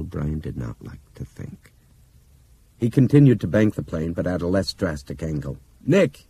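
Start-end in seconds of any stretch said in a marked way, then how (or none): 5.9-6.3 a loud, high shout over the cockpit noise; shouted call. Acted. Pilot shouting back to his injured copilot, loud call. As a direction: yell his name without turning. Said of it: "he shouted without turning around".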